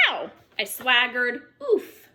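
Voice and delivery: usual speaking voice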